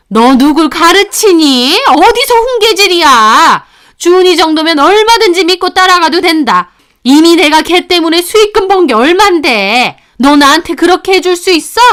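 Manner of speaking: loud, high-pitched angry mother-in-law; angry scolding. Acted. Mother-in-law berating her daughter-in-law for lecturing her, angry shouting.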